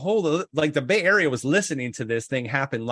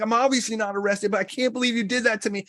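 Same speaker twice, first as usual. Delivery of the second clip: higher, hammed-up outraged rant; mock angry; 9 semitones higher, faster than usual. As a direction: storm in and chew them out. He storms in on the hosts.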